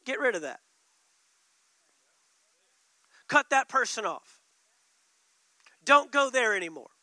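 firm, loud orders one after another; commanding voice. Acted. Preacher voicing the Spirit, firm loud commands. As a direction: lay down the law like a strict guide.

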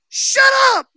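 sudden loud high shout; loud rebuke in Jesus' voice; commanding shout. Retold or imitated. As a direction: blast it at the demon. Commanding the demon.

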